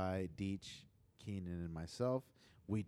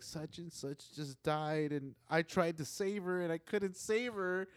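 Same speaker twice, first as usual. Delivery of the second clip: hammed-up kid upset over a game death; imitating crying son; 7 semitones higher than usual. Dad imitates his crying son for friends, mock tearful. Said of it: "my son's coming out crying".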